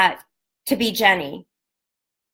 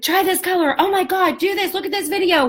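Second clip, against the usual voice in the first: higher, bubbly mock excitement; high-pitched imitation of a thrilled friend; imitating excited friend; 8 semitones higher than usual. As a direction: gush about lipstick like her friend.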